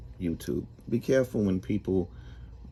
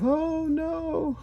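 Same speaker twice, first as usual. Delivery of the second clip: shrill, much higher cry of dread; horrified cry. Acted. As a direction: yelp it like you've just seen the demons. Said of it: "And he's like".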